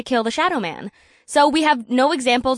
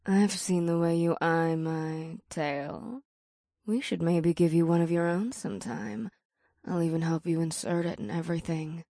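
low, soft, slow seductive purr; succubus purr; 3 semitones lower, 5 dB quieter than usual. A narrator's reading. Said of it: "The succubus purred".

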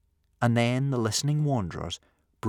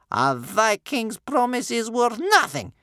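high, loud, slow scorn; spat contempt; 7 dB louder, slower than usual. A narrator's reading. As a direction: spit the words out, grandmother voice. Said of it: "spat Bear Cub's grandmother".

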